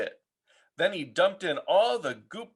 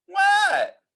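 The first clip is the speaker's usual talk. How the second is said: calling back; 8 dB louder than usual. Acted. Froggy answering a call.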